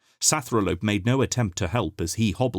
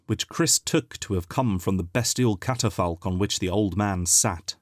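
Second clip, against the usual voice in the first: ordinary delivery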